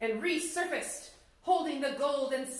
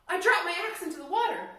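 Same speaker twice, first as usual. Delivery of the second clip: higher, louder and fast; flustered reply; 7 semitones higher, 6 dB louder, faster than usual. Acted. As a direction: blurt it out in a nervous rush. Startled logger answers old man.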